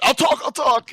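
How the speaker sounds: high, frantic begging orc; panicked orc. Retold or imitated. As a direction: beg like a tortured orc giving in. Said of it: "the orc said".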